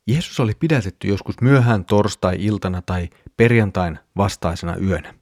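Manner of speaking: ordinary talk, nothing marked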